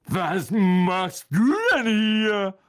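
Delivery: loud, slow, high astonished question; surprised. A narrator's reading. Ron runs into Percy in the dungeons, surprised. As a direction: stretch it out in disbelief. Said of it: "sagte Ron überrascht".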